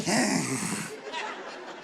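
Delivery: squashed, drawn-out mock laugh; no-neck laugh. Shown as a demonstration. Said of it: "if you got no neck".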